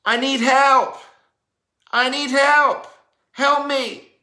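high, loud desperate plea; a needy woman calling for help, loud and high; pleading cry for help. Retold or imitated. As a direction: cry out for help like someone in need. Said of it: "when you hear that voice saying".

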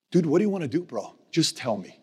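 ordinary talk, nothing marked

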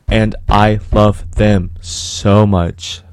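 loud, drawn-out love them so much; aggressive. Real, not acted. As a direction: belt it out with fierce love. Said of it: "Sorry, didn't mean to get aggressive".

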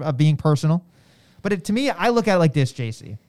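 normal talking voice

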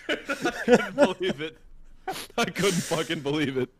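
high-pitched, through real laughter; laughing disbelief. Real, not acted. Teller cracking up at his own story, laughing disbelief.

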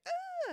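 piercing high loud shriek; mandrake scream. Retold or imitated.

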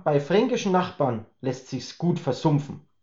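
plain reading voice

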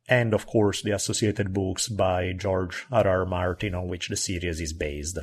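normal talking voice

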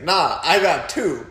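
loud, high, drawn-out cocky chirp; mock boastful taunt. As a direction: rub it in like a gloating rival, stretching the words. Acting out opponent's boastful chirp.

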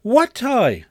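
put-on high, loud boy's question; clueless kid. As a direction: call back as a puzzled child. Boy feigns ignorance, calling back.

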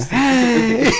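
high-pitched mock kiddie squeal; kids cheering. Joking about imagined kids to his friends, high kiddie cheer. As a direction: squeal it out like a crowd of tiny kids. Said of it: "all you hear is the kids going".

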